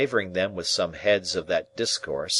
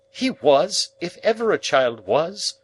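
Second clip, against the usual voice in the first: much higher, louder insistence; most emphatic; 10 semitones higher, 6 dB louder than usual. Acted. Sister insisting about the boy, most emphatically. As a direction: slam the point home. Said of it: "most emphatically".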